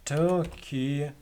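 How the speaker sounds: ordinary talk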